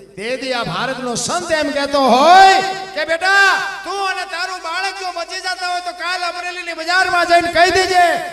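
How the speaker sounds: loud, high ringing declaration; preaching climax. Real, not acted. Preacher voicing the saint's offer at full force. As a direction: hammer home the saint's offer.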